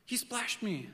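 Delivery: put-on high little kid complaint; lightly voiced whiny kid; tattling kid voice; child tattling. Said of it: "one of them is like".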